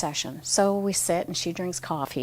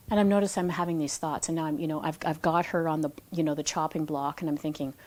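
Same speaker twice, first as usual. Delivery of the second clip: ordinary talk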